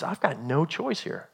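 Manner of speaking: slow, soft admission to oneself; resigned realization. Acted. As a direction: let it sink in, quietly and slowly. Captain resigns himself in story.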